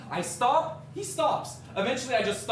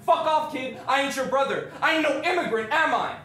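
loud, high, heated retort; angry rejection; 5 semitones higher, 5 dB louder than usual. Retold or imitated. Man denies being kid's brother, loudly angry. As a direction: shove the kid off with your voice.

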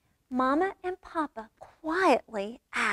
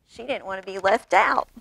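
plain reading voice

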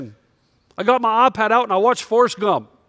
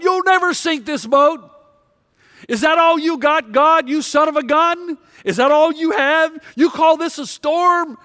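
defiant yell at God; 7 semitones higher than usual. Retold or imitated. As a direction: rage at the sky like a man on a mast. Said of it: "And he yells".

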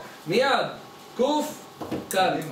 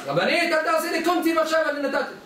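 mock-exasperated outburst in a raised pitch; acted indignant shout; 7 semitones higher than usual. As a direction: play a flustered man raising his voice. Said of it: "למה אתה צועק".

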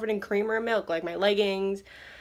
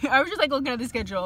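ordinary talk, nothing marked

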